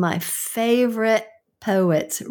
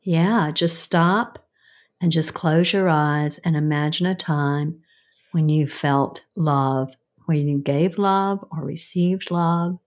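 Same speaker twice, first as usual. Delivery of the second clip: guided meditation; 5 semitones lower than usual. Acted. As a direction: gently lead them into a memory. Leads a short guided visualization.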